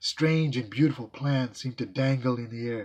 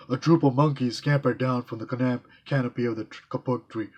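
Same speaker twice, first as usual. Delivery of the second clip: plain reading voice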